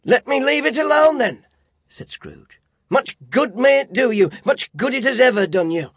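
loud, high sarcastic retort; bitter scoff. A narrator's reading. Scrooge dismissing his nephew's Christmas, bitter scoff. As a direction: sneer it out.

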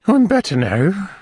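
high falsetto character voice; recovering lady. A narrator's reading. Lady falls back after drinking, high recovering voice. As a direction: pitch it up as the relieved lady.

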